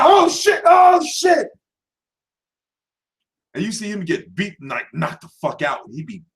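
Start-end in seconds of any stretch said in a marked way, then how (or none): 0.0-1.5 high shocked yell of oh shit; yelling at the TV as he goes down; excited fan shout. Retold or imitated. Fan watching Khan fight, shouting in shock.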